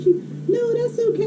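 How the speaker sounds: high, soft reassuring lady voice; soothing lady. Retold or imitated. Lady telling the boy it's fine, soothing. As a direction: imitate a forgiving lady, light and high.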